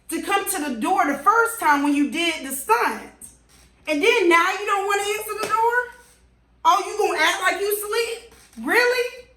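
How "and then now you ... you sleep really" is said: high, fast, mocking disbelief; incredulous mockery. Real, not acted. To the hiding neighbors, high mocking disbelief. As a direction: scoff at them for playing asleep.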